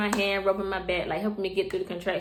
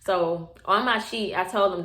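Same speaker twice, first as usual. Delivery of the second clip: ordinary talk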